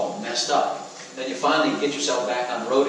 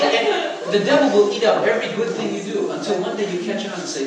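normal talking voice

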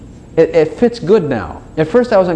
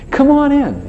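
welcoming call; 13 semitones higher, slower than usual. Acted. God to the sinner outside, welcoming call. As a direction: call them inside, pitched way up.

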